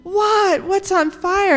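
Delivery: high-pitched startled question from Mom; acted alarm. A narrator's reading. Mom answering her son's fire warning, acted alarm. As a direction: react with storybook shock, voice jumping up.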